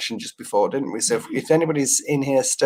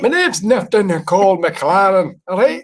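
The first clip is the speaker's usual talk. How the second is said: put-on Belfast accent, higher and louder; 4 semitones higher, 4 dB louder than usual. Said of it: "can you just do a Belfast accent?".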